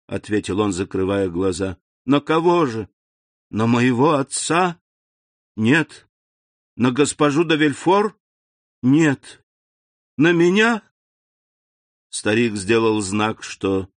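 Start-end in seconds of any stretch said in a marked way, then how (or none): 2.0-10.8 rising anxious guesses, a touch louder; a granddaughter pleading for an answer, anxious and rising; worried questions. A narrator's reading. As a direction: press him, growing uneasy.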